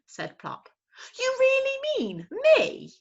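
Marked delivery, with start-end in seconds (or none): 1.2-2.9 high, slow delighted squeak; can't believe his luck; thrilled owlet. Acted. Owlet thrilled to be drawn.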